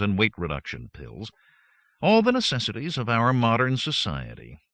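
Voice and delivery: usual speaking voice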